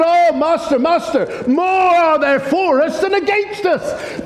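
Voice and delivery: the servant gasps out his wonder; awed servant. Acted. Servant voiced in awe.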